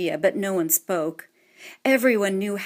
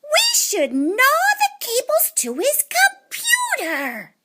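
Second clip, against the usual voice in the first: much higher, slow squeaky little mice voices; twins in one voice; 4 dB louder, slower than usual. A narrator's reading. Twin mice speaking up together to break the silence, high and squeaky. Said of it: "spoke up loudly in one voice".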